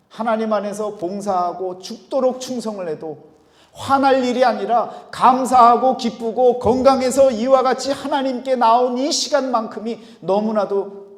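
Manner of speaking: loud, high, sustained sermon delivery; impassioned preaching. Real, not acted. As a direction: preach it out with full force. Preacher builds at full force.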